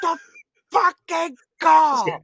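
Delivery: slow, high, very loud imitated rage; explosive yell. Officer demanding a phone call, hammed-up explosive yell. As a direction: bellow each word separately, hugely overdone. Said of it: "went from zero to one hundred".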